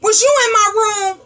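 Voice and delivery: put-on high accusing teen whine; whiny daughter. Daughter accusing her mom of snooping, high and whiny. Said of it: "had the nerve to come home and say".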